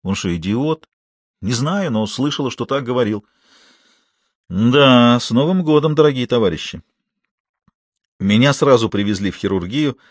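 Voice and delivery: ordinary delivery, nothing marked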